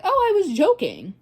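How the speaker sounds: mock his careless excuse; mocking boyfriend.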